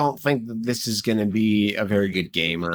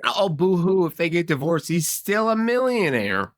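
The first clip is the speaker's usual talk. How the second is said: high-pitched, incredulous mock pity; mocking sympathy; 10 semitones higher than usual. Arguing that a divorced man stays rich, high mocking outrage. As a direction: scoff at his hardship in a high voice.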